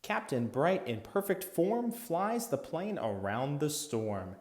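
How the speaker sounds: ordinary delivery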